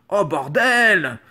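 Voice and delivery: drawn-out disbelieving "Oh bordel!"; stunned exclamation. Real, not acted. A vlogger floored by an easy quiz question, drawn-out disbelief.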